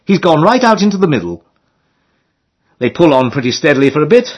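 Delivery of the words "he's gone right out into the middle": put-on startled girl. A narrator's reading. Girls on the towpath spotting the drifting boat, high surprised cry. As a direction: exclaim like a surprised young girl. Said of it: "they say".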